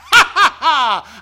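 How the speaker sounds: loud, slow mocking cackle; devil's laugh. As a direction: laugh slowly like a gloating devil. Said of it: "the devil would laugh".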